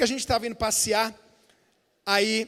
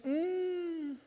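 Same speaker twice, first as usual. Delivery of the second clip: put-on soft, sly little hint from a child; son's hinting voice; 8 dB quieter, much slower than usual. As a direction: drop the voice to a coy hinting murmur. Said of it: "Ele fala assim". (voz mansa de criança dando indireta)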